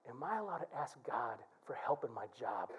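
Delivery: soft, low, hesitant asking; a shy churchgoer asking permission; timid question. Retold or imitated. Congregant asks pastor timidly.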